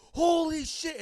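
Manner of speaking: high, loud horrified exclamation; onlookers' shock. Retold or imitated. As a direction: imitate a crowd reacting in shock. Onlookers react to impaled man.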